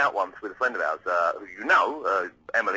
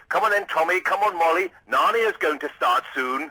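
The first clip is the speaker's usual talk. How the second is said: put-on higher, louder posh dad calling his kids; 6 semitones higher, 4 dB louder than usual. Posh dad calling his children out of the restaurant, theatrical. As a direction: call the kids like a theatrical middle-class father. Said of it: "he went".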